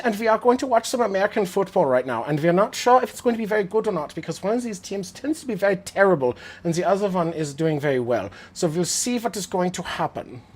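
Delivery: hammed-up German accent, pitched high. Streamer showing chat his fake German accent. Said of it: "I can do the German accent".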